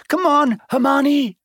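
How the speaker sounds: very high, carrying call. A narrator's reading. Ron to Hermione up above, calling out. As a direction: call loudly up to a friend. Boy calls up to friend above.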